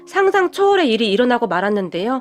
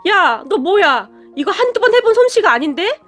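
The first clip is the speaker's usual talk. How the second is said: a husband yelling after a punch in the nose; yelling at the top of his lungs; 7 semitones higher than usual. Acted. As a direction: bellow it at her in disbelief. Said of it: "고래고래 소리를 질렀다고".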